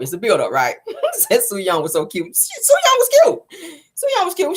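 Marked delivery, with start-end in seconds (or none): 2.3-4.6 gushing. Acted. Gushing over Sue Young's looks.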